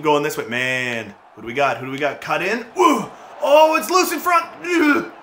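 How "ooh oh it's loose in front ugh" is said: high, loud excited shout; much higher, louder burst of excitement. Real, not acted. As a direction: yell it as the puck squirts loose. Loose puck in front.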